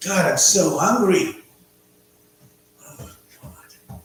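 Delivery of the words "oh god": whisper. Real, not acted. Hungry, groaning to himself.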